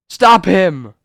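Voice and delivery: sharp loud cry to stop the fight, high; urgent shout. Acted. Trapped man shouting to stop the fight, urgent loud cry. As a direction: yell it out to hold him back. Others halt the attack.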